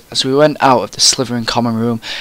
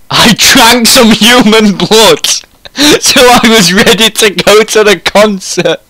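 high, loud reading through laughter; laughing; 9 semitones higher, 6 dB louder than usual. Real, not acted. Reader finishing the absurd passage, laughing. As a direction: fight the giggles while reading on, pitched up.

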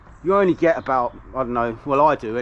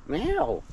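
high mock meow at the cat; playful imitated meow, high; 7 semitones higher, 3 dB quieter than usual. Cat is back, meows at it.

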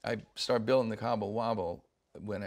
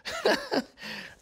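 sudden high laugh. Real, not acted. Asked about other businesses.

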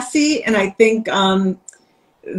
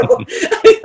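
high airy laugh; 13 semitones higher than usual. Real, not acted. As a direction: burst out laughing. Laughing at her own joke.

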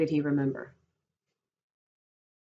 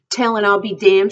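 loud, higher, angry outburst; crying out; 6 semitones higher, 12 dB louder than usual. A narrator's reading. Curly's wife defies Candy's threat to tell, crying out. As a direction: fling the threat back loudly. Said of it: "she cried".

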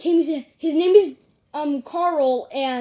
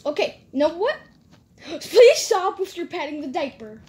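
ordinary talk, nothing marked

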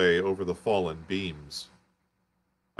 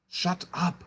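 terrified hush; 10 semitones higher than usual. Acted. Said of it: "in a terror lest the Martians should hear us".

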